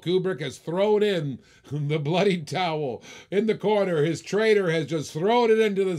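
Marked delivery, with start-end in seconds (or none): none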